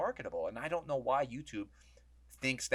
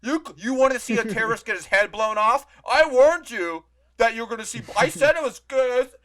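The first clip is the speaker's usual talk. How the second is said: mock YouTube voice; 10 semitones higher, 14 dB louder than usual. Mocking YouTube to podcast friends, in a high put-on voice. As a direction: sneer like a smug company covering itself. YouTube mocked as warning viewers.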